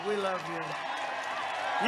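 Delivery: slow and soft, savouring "We love you"; tender, slow echo. Shown as a demonstration. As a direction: let "We love you" drift out slowly and gently, lingering on each word. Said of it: "Think of that".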